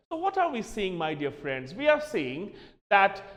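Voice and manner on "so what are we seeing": normal talking voice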